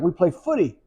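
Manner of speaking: high-pitched imitation Australian; Australian accent. Young Australians telling tourists what they do, imitated Australian accent. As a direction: answer casually in a put-on Australian accent. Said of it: "this Australian accent".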